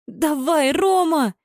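high-pitched excited squeal. A narrator's reading. Anya cheering Roma on, squealing. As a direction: squeal encouragement at the player. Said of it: "взвизгнула Аня".

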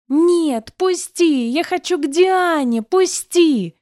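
put-on high loud child's scream to be let go; a little girl shrieks and struggles; child screams at mother to let her go. A narrator's reading. Child fights free, screaming.

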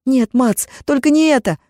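high desperate plea. Acted. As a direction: beg him not to leave. Malta begs Mats not to go.

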